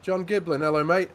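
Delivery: big excited welcome; cheerful greeting. Real, not acted. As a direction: call out the name warmly and loudly. Greeting a new viewer on stream.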